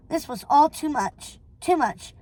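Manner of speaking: ordinary delivery, nothing marked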